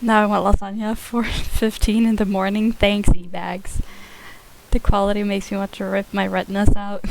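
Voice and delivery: usual speaking voice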